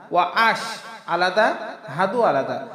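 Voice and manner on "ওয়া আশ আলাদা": usual speaking voice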